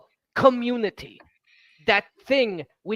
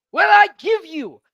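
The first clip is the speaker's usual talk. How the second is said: dopey cartoon bellow. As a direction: boom it out like an ogre. Ogre character bellows.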